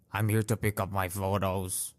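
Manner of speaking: low curt imitation of a rude lady; mock a rude customer flatly. Imitating the rude lady demanding her photos at the counter.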